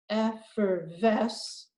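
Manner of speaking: Effervesce stretched very slowly; slow blend. Shown as a demonstration. Teacher blending the chunks together, very slow. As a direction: draw out Effervesce. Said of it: "Then I add this chunk to it".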